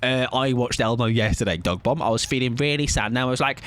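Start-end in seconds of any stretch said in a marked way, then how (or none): none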